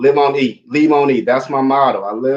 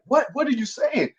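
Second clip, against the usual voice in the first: baffled; 12 semitones higher, 6 dB quieter than usual. Retold or imitated. Listener's puzzled reaction, acted out.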